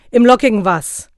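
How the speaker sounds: loud, high pouncing question; sharp demand. A narrator's reading. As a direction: snap the question at him. Santa pounces on the gap.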